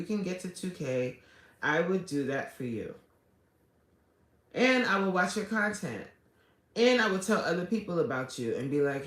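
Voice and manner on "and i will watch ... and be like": normal talking voice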